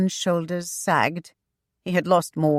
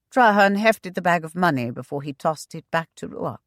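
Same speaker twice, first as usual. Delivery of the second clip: ordinary delivery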